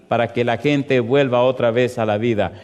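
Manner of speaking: ordinary talk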